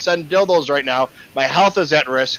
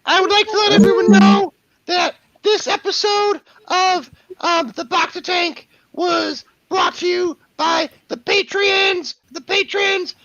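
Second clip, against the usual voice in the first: high cartoon dog announcer; slower than usual. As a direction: read the patron list as the dog. Sponsor thanks in dog voice.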